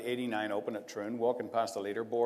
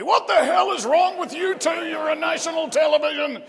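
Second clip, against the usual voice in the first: much higher and louder mock shout; producer yelling; 10 dB louder than usual. Producer screaming at the two on air, shouting. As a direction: bellow it like a furious producer in your earpiece. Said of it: "my producer is yelling at me".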